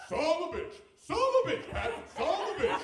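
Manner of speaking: loud, high mock-toddler exclamation; kicked-in excitement. Three-year-old thrilled by new curse, imitated excited shouting. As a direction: belt the new curse out with childish delight. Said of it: "then it kicked in".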